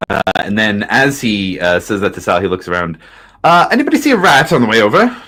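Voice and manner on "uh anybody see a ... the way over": high and quick, bright-eyed question; high, fast NPC voice. Acted. GM voices NPC asking about rat.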